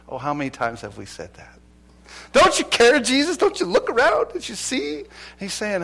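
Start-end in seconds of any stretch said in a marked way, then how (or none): 2.3-5.0 much higher and louder, frantic pleading; panicked disciples. Acted. Frightened disciples wake Jesus in the storm, panicked high pleading. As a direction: cry out like men sure the boat is sinking.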